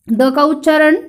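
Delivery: normal talking voice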